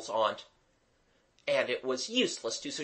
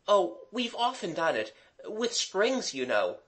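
put-on higher, airy boy's voice; explaining boy; 7 semitones higher than usual. A narrator's reading. Gerald explaining the trick to Mademoiselle, casual. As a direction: toss off the explanation in a higher pitch.

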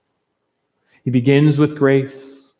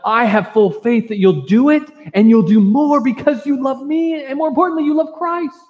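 much higher, climbing with joyful conviction; mounting fervor; 11 semitones higher, 3 dB louder than usual. Acted. Preacher voicing Paul's confidence in Philemon, higher and building. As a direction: build it up, rising higher with each line.

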